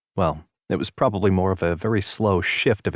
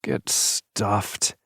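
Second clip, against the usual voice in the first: whispered grumpy growl; 4 dB quieter than usual. A narrator's reading. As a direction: grumble it hungover. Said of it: "Ronan growled".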